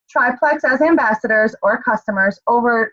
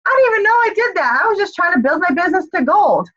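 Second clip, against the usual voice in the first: much higher, fast, surprised voice; Roxanne surprised; 8 semitones higher than usual. Retold or imitated. Coach quoting a teammate who won unexpectedly, high and fast. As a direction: squeal it like a happy surprise. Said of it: "and she's like".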